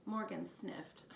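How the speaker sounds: plain reading voice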